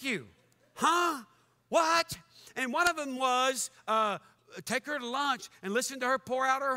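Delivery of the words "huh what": sharp high disbelieving Huh and What; incredulous. Real, not acted. Preacher reacting to the blog list, loud disbelief.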